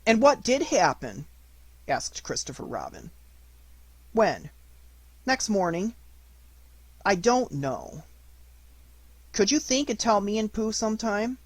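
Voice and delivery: lightly voiced curious child; little boy. Christopher Robin as child.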